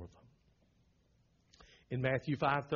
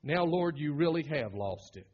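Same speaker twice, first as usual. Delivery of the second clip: ordinary talk, nothing marked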